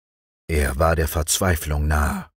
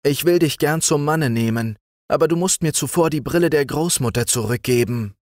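put-on higher, quick girlish voice; young girl voice; 8 semitones higher than usual. A narrator's reading. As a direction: plead as a young bride. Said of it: "sagte dem Sultan".

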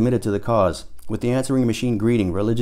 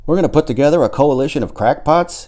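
incredulous question; 6 semitones higher, 4 dB louder than usual. A narrator's reading. Tom leaps up, incredulous.

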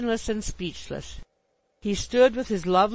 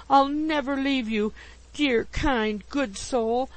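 higher-pitched clinging declaration; frantic devotion; 6 semitones higher, slower than usual. A narrator's reading. Landlady clings tighter to Mister Pickwick, frantically devoted. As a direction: vow it with effusive fervour. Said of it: "exclaimed Missus Bardell frantically".

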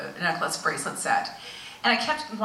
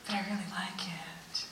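soft, slow whispered guilt; guilty whisper; 12 dB quieter than usual. Retold or imitated. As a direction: whisper it to yourself, torn. Guilty inner voice.

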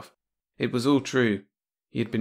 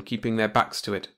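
ordinary delivery, nothing marked

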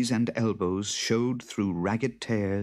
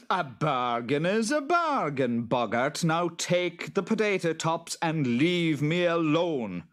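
higher, insistent farmer voice; firm farmer; 10 semitones higher than usual. A narrator's reading. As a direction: hold the creature firmly to the deal. Farmer holds boggart to bargain.

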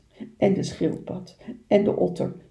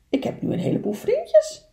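amazed little beaver. Acted. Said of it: "zei Kleine Bever verbaasd".

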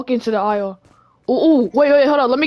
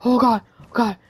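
ordinary delivery

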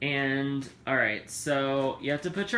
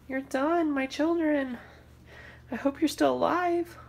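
high doting; 12 semitones higher than usual. Acted. High tender to kale.

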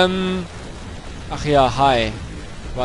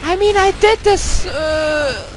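high put-on English girl voice, raised all through; a falsetto girl persona ranting in English at the game; 7 semitones higher than usual. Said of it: "I'm playing the English girl right now".

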